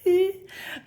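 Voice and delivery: short high-pitched laugh; a giggle breaking out. Real, not acted. Laughs at her own voice.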